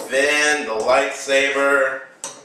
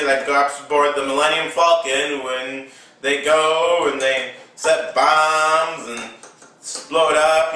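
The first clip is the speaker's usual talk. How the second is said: normal talking voice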